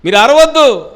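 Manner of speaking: loud, high scolding; hushing rebuke. Acted. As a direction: shut the crowd up sharply. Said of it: "అంట".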